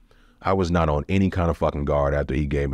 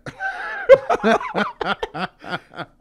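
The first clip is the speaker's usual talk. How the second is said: high disbelieving laugh. Real, not acted. Told six years ain't bad, laughing. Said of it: "that ain't too bad".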